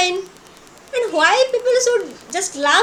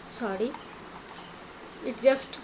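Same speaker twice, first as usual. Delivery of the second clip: ordinary talk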